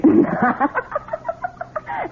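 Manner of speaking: a high, mocking laugh at his offer; scornful laugh. Wife mocks the loan claim.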